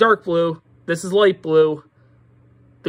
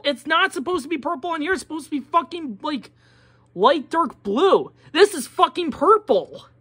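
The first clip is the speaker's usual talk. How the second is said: angry rant; 7 semitones higher than usual. Real, not acted. Furious rant about wrong colors.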